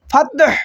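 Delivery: ফাতহ with a bounced final হ; qalqala on fath. Shown as a demonstration. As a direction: end ফাতহ with a light echo. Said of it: "এইভাবে". (ফাতহ শেষে হালকা কলকলা)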